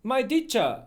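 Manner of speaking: Italian accent, my teacher as my deacha; in an Italian accent, my teacher said with a D and open final a. Shown as a demonstration. Showing Italian pronunciation, Italian accent. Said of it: "Italian people say teacher".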